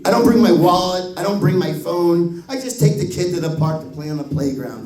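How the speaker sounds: normal talking voice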